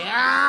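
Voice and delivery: sudden high mock yell; a quick high lunging cry; attacker's yell. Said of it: "He was like".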